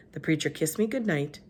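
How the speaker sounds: plain reading voice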